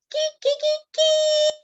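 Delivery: high crowing rooster call kee kee. A narrator's reading. Rooster crowing at dawn, high and bright. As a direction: sing it out high like a little rooster. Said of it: "He sang".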